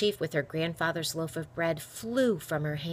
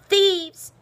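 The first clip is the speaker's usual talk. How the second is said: high, loud cry of alarm; one big outraged yell; shouting; 10 dB louder than usual. A narrator's reading. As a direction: shout it across a crowded street. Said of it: "she could hear herself shouting".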